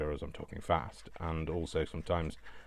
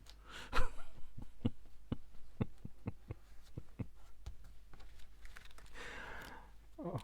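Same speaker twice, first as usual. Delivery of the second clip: long, high, breathy laughing fit; helpless laughter; 5 dB quieter than usual. Real, not acted. Crafter laughing at own silly song, helpless laughter. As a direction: crack up until nearly silent.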